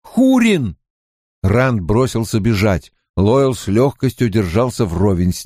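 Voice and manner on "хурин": high, sudden exclamation of a name; alarmed cry. A narrator's reading. Rand realises his friend is in danger, alarmed cry.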